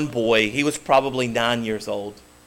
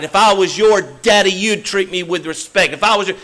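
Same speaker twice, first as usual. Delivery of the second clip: loud, high barking drill sergeant yell; 4 semitones higher, 6 dB louder than usual. Retold or imitated. Drill sergeant yells at troubled boy on stage, loud and high. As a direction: bark it in the boy's face to scare him. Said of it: "he was trying to scare him".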